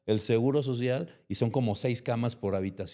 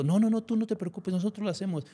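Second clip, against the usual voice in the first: mock fussy helpers; 10 semitones higher than usual. Others keep him from helping.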